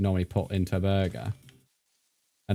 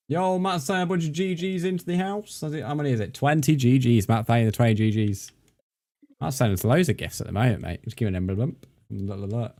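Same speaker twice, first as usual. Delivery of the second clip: ordinary talk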